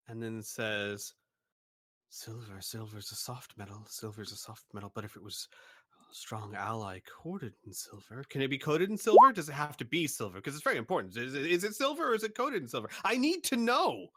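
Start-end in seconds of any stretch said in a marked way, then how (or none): 2.1-8.2 murmured shopkeeper. Acted. GM voices shopkeeper muttering. 8.3-14.1 higher, louder and fast, climbing into panic; anxious rush. Acted. Shopkeeper pressing the customer about the silver in a rising fast panic.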